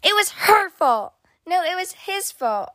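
put-on high, childish squabbling shout. A narrator's reading. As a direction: kids yelling blame at each other. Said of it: "pointing at each other and shouting".

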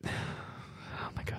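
slow, hushed dread; awestruck whisper. Acted. Spotting something terrible at Big Ben, slow awed whisper. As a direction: whisper it slowly as if frozen.

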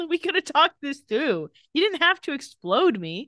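high, plaintive comic protest; mock aggrieved. Henry protesting to Damon about being exploded, mock aggrieved. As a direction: plead it high as the wronged victim. Acting Henry's complaint after grenade.

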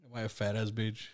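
ordinary delivery

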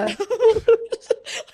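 giddy high-pitched laugh. Real, not acted. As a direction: burst into delighted laughter. Shared laughter.

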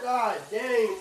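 normal talking voice

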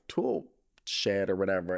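ordinary talk, nothing marked

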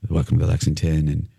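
mock a mild-mannered ghost hunter; understated low droning presenter; imitating a calm presenter. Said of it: "this is one of Dawn's co".

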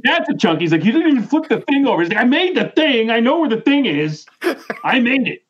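high, fast irritated rant; hammed-up high exasperation; exasperated host. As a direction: play the fed-up host up high.

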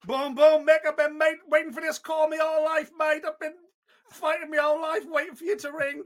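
high-pitched, excitable mock Australian; Basil gone Australian. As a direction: shout down the phone in a bad Australian accent. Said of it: "I didn't know you're Australian".

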